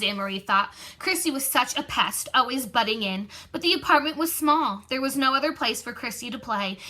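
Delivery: ordinary delivery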